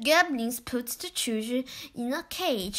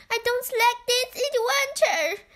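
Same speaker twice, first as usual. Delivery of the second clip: put-on high, fast, worried girl; Beth's voice; 10 semitones higher, faster than usual. A narrator's reading. Beth fears the bubbles will pop, high and hurried. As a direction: rush it out, pitched up and anxious. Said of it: "said Beth".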